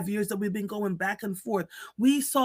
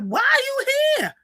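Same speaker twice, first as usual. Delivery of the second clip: put-on very high, squeaky little-girl voice; mock a small child's baffled question; high-pitched child's voice, puzzled; imitating the girl; 7 dB louder than usual. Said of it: "the girl was like".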